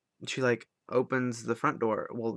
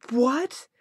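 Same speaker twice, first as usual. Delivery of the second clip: very high, loud startled cry; shocked yelp; 8 dB louder than usual. Retold or imitated. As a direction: blurt it out in sheer alarm. Hearing friend was hugged and robbed.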